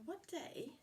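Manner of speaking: ordinary delivery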